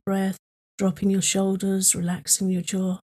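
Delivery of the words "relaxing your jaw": ordinary talk, nothing marked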